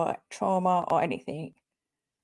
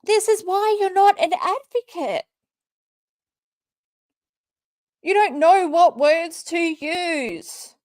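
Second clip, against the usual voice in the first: exasperated anger; 9 semitones higher than usual. Real, not acted. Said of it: "I'm just so fucking angry".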